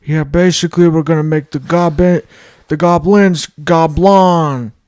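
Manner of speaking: hammed-up, booming politician announcing a plan; Joe Biden. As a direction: declare it loudly as a caricatured president. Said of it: "it's fucking Joe Biden".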